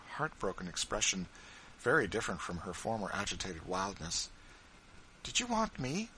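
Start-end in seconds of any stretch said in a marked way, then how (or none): 5.2-6.1 high, gentle question without extra volume. A narrator's reading. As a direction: ask tenderly in a lifted voice. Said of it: "said Missus Bird in gentle tones".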